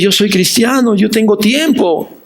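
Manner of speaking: a believer complains to his pastor; complaining believer. Retold or imitated. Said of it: "Tú dices". (lamento quejoso de creyente, más agudo)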